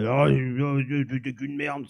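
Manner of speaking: a hint of a mean commenter, kept soft; villain voice. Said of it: "on lui fait une grosse voix de méchant". (esquisse de voix de méchant)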